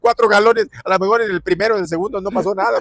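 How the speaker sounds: high, loud, amused exclamation; animated outburst. Real, not acted. Coach jokes back about the doctor's tugs on the shoulder, animated and loud. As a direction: blurt it out high and loud over the laughter. Loud joking reply amid laughter.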